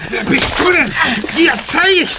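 angry shout while scuffling; fist fighting. Acted. Scuffle with attacker.

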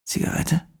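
leise, raunende Fremdenstimme; düster und gedämpft aus dem Schatten; unheimlicher Fremder. A narrator's reading. Fremder bietet Zigarette an. (geheimnisvoller Fremder im Dunkeln, leise raunend)

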